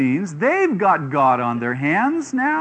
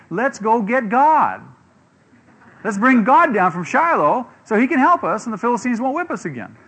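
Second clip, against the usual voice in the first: mock plea; 6 semitones higher, 4 dB louder than usual. Israelites want to fetch God.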